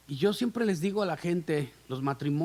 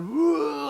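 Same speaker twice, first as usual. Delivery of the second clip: high, loud, drawn-out "uh" of a thirsty deer; loud imitated deer cry; animal cry; 8 semitones higher, 5 dB louder than usual. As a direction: call out like a deer bellowing. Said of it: "Y empieza a gritar".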